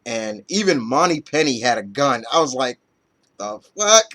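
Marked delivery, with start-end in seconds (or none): 3.4-4.1 high, loud incredulous outburst; shocked disbelief. Retold or imitated. Reviewer recalling his shock at the scene, loud stunned outburst. Reacting to gun drawn in scene.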